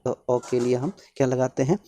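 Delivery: ordinary delivery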